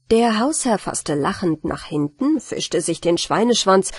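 ordinary delivery, nothing marked

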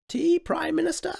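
high, loud polite cut-in; high queenly interruption; queen interrupting. Acted. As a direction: cut him off sweetly. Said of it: "interrupted the Queen".

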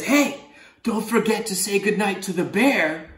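lightly voiced octopus, teasing. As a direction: nudge him like a cheeky friend. Octopus reminds the boy.

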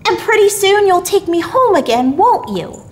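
put-on high, loud pleading little boy; small boy seeking reassurance; child's voice. A narrator's reading. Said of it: "said the child".

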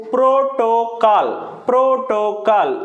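ప్రోటోకాల్ slowly in three parts, ప్రో-టో-కాల్; syllable-by-syllable demo. Shown as a demonstration. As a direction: stretch each syllable of ప్రోటోకాల్.

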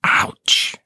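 whispered little ouch; hurt cat whispering; soft ouch. A narrator's reading. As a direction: breathe the ouch out softly. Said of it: "said Tony softly".

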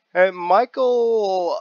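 high, drawn-out unsure call of Michael; questioning call. Retold or imitated. A stranger unsure of his name calls out, high and questioning. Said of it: "followed by a giant question mark".

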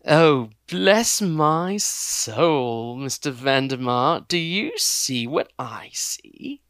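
slow, loud, relishing the find; creepy gentleman. Acted. Villain gloats to his partner, slow and relishing. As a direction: gloat slowly over the helpless girl. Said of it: "The voice was soft".

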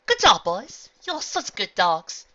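high, loud then slow praise; praising pets. Acted. Praising diamond dogs.

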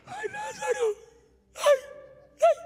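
very high, falsetto mock cry for Lázaro; mock wailing. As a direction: wail it like a weeping mourner, hammed up. Jesus weeping for Lazarus.

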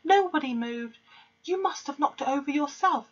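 lightly voiced schoolchildren crying out; class crying out. A narrator's reading. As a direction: plead together like a class. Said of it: "they cried out".

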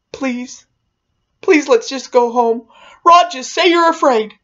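put-on high-pitched boyish begging; imitated pleading. Jason begging his brother Rod to admit fear, pleading.

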